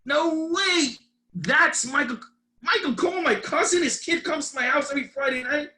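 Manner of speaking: high, loud burst of astonished recognition; freaking out. Retold or imitated. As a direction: retell it like you can't believe the coincidence. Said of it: "I freak out".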